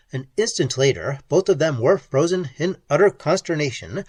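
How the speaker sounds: ordinary delivery, nothing marked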